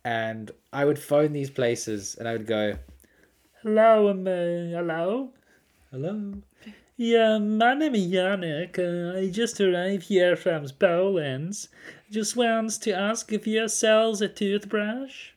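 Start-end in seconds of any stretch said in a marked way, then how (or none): 3.6-15.3 put-on slow, high Polish-accented caller; hesitant prank call in a Polish accent. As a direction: play a halting newcomer from Poland, drawn out and high. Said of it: "if somebody phones you with a strange accent".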